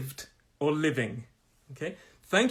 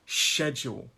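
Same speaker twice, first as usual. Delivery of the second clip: SCHEDULE stressed on "SHED", falling; first-syllable stress. Shown as a demonstration. Teacher demonstrates stress, SCHEDULE stressed on first syllable. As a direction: lean on the start of SCHEDULE. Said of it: "to show you where the stress is".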